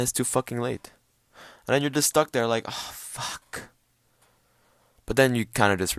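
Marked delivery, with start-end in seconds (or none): 2.7-3.7 voiceless, sighed-out curse; dismayed whisper. Retold or imitated. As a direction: breathe it out in mock defeat. Stuck alone, realising it's too late.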